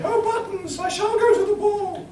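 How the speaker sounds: high falsetto princess voice; Cinderella voice. Acted. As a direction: squeak it like a panto princess. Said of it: "reading for the part of Cinderella".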